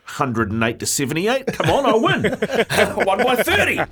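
laughing, smug victory claim; laughing gloat. Pretend. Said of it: "He goes like".

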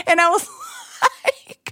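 laughing through the words; giggling as she talks. Real, not acted. Laughing while telling.

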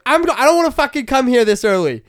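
hammed-up shouting tantrum; mock furious yell. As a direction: rant like an enraged actor refusing early calls. Pesci complaining about early shoots.

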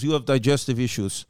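a low imitation of a Russian mover in a Russian accent; mock Russian mover. Retelling the Russian mover's words.

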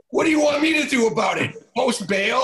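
flustered coach rushing the words; exasperated retold yell. Coach asks what to do.

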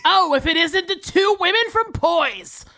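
menacing cartoon villain voice. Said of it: "talks menacingly like a cartoon villain".

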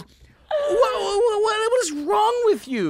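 loud falsetto stammering in mock fury; hammed-up screech, too outraged to get words out; sputtering outraged woman. Lady sputters in outrage, retold.

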